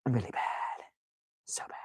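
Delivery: breathy near-whisper. Retold or imitated. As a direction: let it fade to a whisper. Trailing self-pity.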